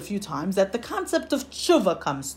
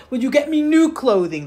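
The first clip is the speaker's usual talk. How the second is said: put-on higher, louder demand of a child; pleading child; 6 semitones higher, 7 dB louder than usual. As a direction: press the request like an insistent child. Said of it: "he continues to cry".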